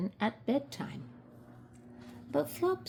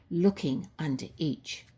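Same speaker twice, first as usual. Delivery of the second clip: ordinary delivery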